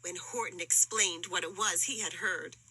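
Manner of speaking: ordinary delivery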